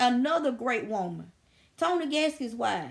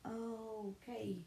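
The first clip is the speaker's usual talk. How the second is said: muttered plotting; 5 semitones lower, 6 dB quieter than usual. Retold or imitated. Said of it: "I'm like".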